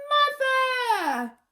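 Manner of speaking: high, loud cry of Mother!; shout. Acted. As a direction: call out across the crowd. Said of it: "a voice shouted out from the crowd".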